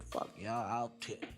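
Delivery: usual speaking voice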